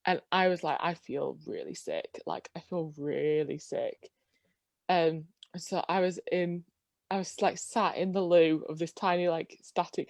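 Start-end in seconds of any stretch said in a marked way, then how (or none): 0.8-4.1 quiet, slow queasy moan; sick and quiet. Retold or imitated. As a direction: sound like you might throw up, softly and slowly. Sick on the trip.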